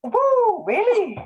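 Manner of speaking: high-pitched astonished whoop; teasing squeal of disbelief; surprised. Real, not acted. Guest reacting to the host's age claim, surprised.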